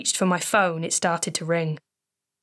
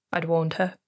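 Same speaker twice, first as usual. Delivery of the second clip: ordinary delivery, nothing marked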